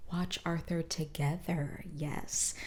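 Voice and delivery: soft breathy whisper. Real, not acted. Vlogger thanks viewers for watching Arthur, whispered. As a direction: let it drop to a secret whisper.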